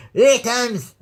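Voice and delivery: VICTIMS blurted high, first consonant swallowed; mock an angry, poorly enunciated VICTIMS; imitating angry slurring. Vlogger mocks Matt's unclear angry promo, VICTIMS slurred high. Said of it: "when he's trying to be angry".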